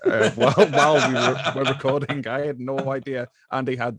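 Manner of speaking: words bubbling through real laughter; laughing while talking. Real, not acted. Host recalling the Cats episode, laughing as he talks. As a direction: laugh through the line, delighted.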